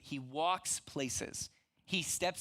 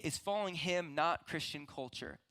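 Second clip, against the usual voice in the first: ordinary delivery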